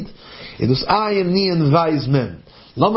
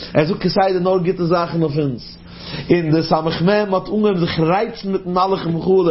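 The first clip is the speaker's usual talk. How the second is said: usual speaking voice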